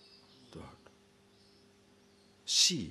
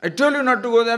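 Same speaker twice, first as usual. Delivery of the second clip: higher and louder, stern; scolding parent; 9 semitones higher, 6 dB louder, faster than usual. Acted. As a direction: warn the child off. Scolding a child again.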